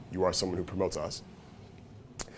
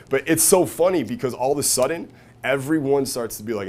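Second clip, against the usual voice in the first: ordinary talk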